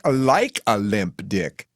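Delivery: slow, proud boast. A narrator's reading. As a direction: drag it out boastfully. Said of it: "she boasts".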